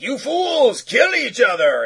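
high, loud villain bellow; hammed-up shout at the fools. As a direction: yell it like a raging war god. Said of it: "and he's like".

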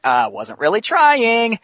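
high, drawn-out boast; cocky comeback. Retold or imitated. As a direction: act out a cocky hero brushing it off. Hero's cocky comeback in retold story.

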